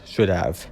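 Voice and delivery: "should have" set apart with emphasis; stress on should have. Shown as a demonstration. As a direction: quote "should have" pointedly, weight on "should". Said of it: "emphasis on the words".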